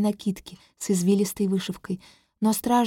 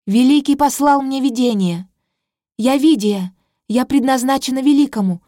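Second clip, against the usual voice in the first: much louder, higher, defiant; loud proclamation; 4 semitones higher, 8 dB louder than usual. Acted. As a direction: declare it to the whole court. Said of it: "Голос набирает громкость". (громкое дерзкое заявление)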